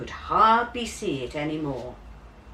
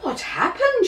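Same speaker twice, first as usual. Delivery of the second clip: concerned teacher; 4 dB louder than usual. A narrator's reading. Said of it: "she said".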